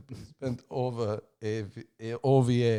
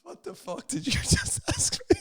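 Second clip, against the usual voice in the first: high, squeezed out through laughter; breaking up laughing; 4 dB louder than usual. Real, not acted. Reading out her shocked tweet reply, cracking up with laughter. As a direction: fight the giggles while reading it.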